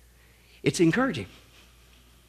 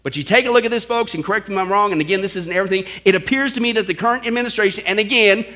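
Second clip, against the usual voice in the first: ordinary talk, nothing marked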